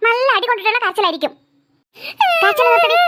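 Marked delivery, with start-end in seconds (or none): 2.4-3.0 high, loud scolding shout; angry shout. Acted. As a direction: yell at the crying child to stop. Mother scolding crying daughter. (കരയുന്ന മകളോട് ഉറക്കെ ദേഷ്യത്തോടെ)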